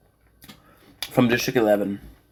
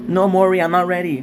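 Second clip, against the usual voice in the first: lightly voiced reluctant talk-show guest, pitched up; mock protest in a raised pitch; 7 semitones higher, 4 dB louder, faster than usual. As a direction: plead that you're not ready, higher voice. Acting a frightened talk show guest.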